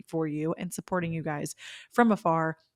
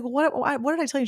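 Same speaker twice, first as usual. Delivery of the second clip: high, excited retold questioning; eager question; 8 semitones higher than usual. Podcaster replays asking her friend about the dream, eager. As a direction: ask eagerly, pitched well up.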